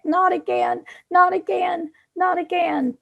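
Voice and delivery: muffled, face in someone's chest; muffled into someone's neck. Acted. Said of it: "she's saying into his neck".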